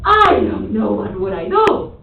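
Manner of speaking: slow, loud, overblown declaration; exaggerated intonation. Shown as a demonstration. Teacher models for repeat-after-me, loud and exaggerated. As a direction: belt it out slowly for the class to copy. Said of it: "I want you to exaggerate".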